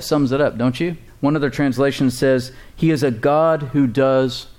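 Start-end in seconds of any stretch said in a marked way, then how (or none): 2.7-4.5 slow declaration. Shown as a demonstration. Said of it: "Say that with me".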